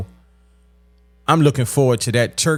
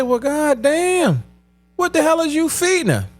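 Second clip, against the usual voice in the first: pitched way up in shock; incredulous; 13 semitones higher than usual. Retold or imitated. Shocked reaction retold.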